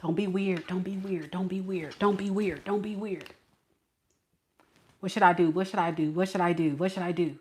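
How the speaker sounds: low, very soft repeated self-coaching; nervous muttering. Acted. Woman coaching herself as the crush approaches, nervous mutter. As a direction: mutter it over and over to yourself.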